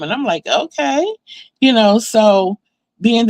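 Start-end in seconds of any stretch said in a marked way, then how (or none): 0.5-1.1 high, rising, puzzled okay; dubious okay. Retold or imitated. Daughter answering sarcastic mom, dubious. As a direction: go along with it doubtfully, pitched up.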